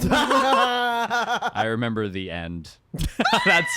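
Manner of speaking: laughing through the words; giggling while speaking. Real, not acted. Laughing while recalling the ending.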